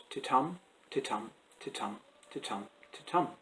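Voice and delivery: "titum" with weak "ti", stressed "TUM"; "ti-TUM" five times, softly chanted beat; iambic rhythm. Shown as a demonstration. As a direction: beat out each "titum" evenly, a gap after each. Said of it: "unstressed beat followed by a stressed beat".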